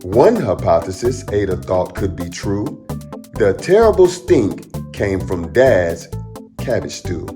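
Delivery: ordinary delivery, nothing marked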